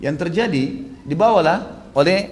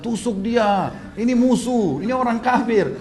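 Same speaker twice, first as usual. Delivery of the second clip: acted urging; 8 semitones higher, slower than usual. Bilal urges the Ansar against Umayyah, high urgent incitement. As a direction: egg the others on to attack.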